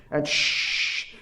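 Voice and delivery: a soft hushing "shh" like a Jedi trick; whispered mind meld shh. Retold or imitated. Speaker mimics Yoda's mind meld for his audience, hushed shh. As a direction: breathe out a mock mystical hush. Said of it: "like Yoda going shh like this".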